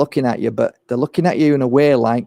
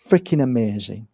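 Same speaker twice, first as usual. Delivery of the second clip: softer, hushed freaking amazing; soft praise; 6 semitones lower, 6 dB quieter than usual. Retold or imitated. Healer praising the parents softly. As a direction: say freaking amazing almost under the breath.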